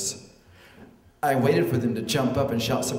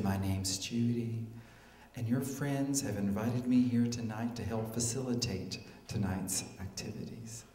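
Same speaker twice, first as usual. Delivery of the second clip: soft-spoken facilitator, low and measured; low, soft tones; 3 semitones lower, 10 dB quieter than usual. Acted. Facilitator introduces herself to the guest, low and soft. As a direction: introduce yourself in a hushed, low voice. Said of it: "spoke in low and soft tones".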